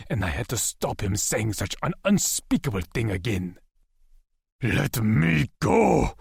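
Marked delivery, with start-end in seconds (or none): 4.6-6.2 slow, loud, drawn-out shout. A narrator's reading. Lex struggling against Wyatt and Asher, slow loud shout. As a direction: fight them off with your voice. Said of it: "I shouted".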